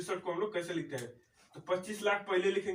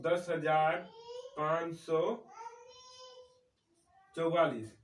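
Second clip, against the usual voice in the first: number read out bit by bit with long pauses; spaced-out teacher's dictation; slow dictation; slower than usual. Shown as a demonstration. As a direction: dictate it slowly so they can write. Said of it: "तो लिखते हैं हम लोग".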